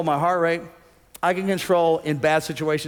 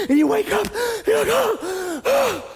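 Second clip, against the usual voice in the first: startled awake, gasping higher and higher; panicked waking; slower than usual. Retold or imitated. Waking from a nightmare, rising panicked gasps.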